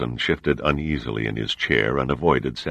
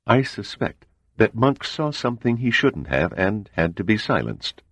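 higher, measured voice of a composed spy chief; cool CIA agent; 8 semitones higher than usual. A narrator's reading. CIA man explains the murder to the admiral, composed. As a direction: lay out the facts coolly and evenly.

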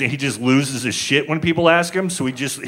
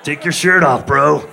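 creepy window guy; 3 semitones higher than usual. Pretend. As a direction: call up like a creep outside the window. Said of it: "the guy outside your window going".

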